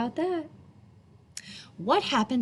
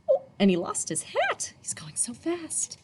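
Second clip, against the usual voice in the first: whisper; 7 semitones higher than usual. A narrator's reading. Aside to listeners, whispered.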